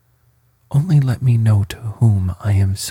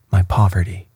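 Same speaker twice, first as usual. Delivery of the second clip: ordinary delivery, nothing marked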